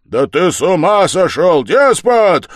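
very loud, high, furious yell; bellowed accusation; roaring. A narrator's reading. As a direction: roar in outrage. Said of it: "заревел Разумихин".

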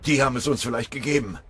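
high, loud, fast gasped words; panting. A narrator's reading. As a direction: blurt it out after running under fire. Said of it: "keuchte der Obergefreite". (hastig und laut hervorgekeucht)

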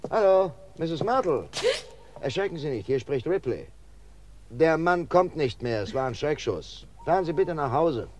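calling to Misses Martle over a radio link; over a transmitter. Acted. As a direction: speak as if through a small loudspeaker. Inspector speaking over a transmitter.

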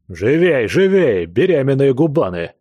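booming drill sergeant yelling; shouting drill sergeant. A narrator's reading. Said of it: "второй офицер с зычным голосом".

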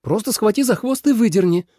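high-pitched, exasperated instruction; impatient heroine. A narrator's reading. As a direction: order him in a high, testy voice. Trapped snake orders rescuer.

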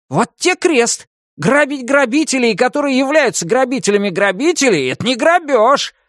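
high, hurried voice of a caught rogue; breathless Menshikov. A narrator's reading. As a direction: protest your innocence, out of breath. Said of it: "развёл руками запыхавшийся Меньшиков". (запыхавшийся Меньшиков)

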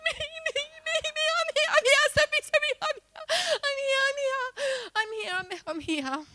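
very high, loud, eager volunteering; eager shouting. Retold or imitated. Jumping up for a miracle, shouting eagerly at the preacher. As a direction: throw your hand up, me me me. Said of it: "I was the first one up".

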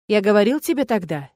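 ordinary delivery

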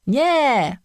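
high, drawn-out cry of protest; high-pitched wailed objection; yelling. A narrator's reading. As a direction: yell a refusal like two outraged creatures. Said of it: "wrzasnęły oba stwory".

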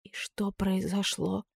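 hushed, slow, fearful question; frightened whisper. A narrator's reading. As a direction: barely dare to ask. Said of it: "прошептала испуганно".

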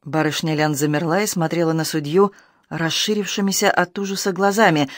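plain reading voice

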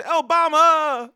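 high, slow shout of Obama; mock street shout. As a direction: call it out long across the street. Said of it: "They say".

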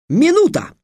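very high, loud gloating cry. A narrator's reading. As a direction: crow it in triumph. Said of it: "злорадно сказал Трикс". (злорадный громкий выкрик)

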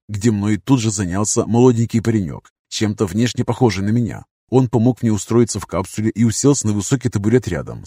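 plain reading voice

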